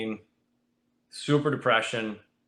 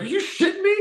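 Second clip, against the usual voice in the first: stunned disbelief. Retold or imitated. Buddies on the porch hearing he signed, high disbelieving shout. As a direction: shriek it like you can't believe it. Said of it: "They're like".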